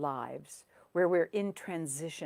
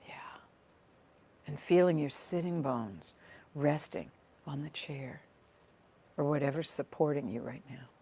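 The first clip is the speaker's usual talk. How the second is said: guided meditation. Real, not acted. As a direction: gently guide listeners into their breath, leaving space between phrases. Leads a guided body scan.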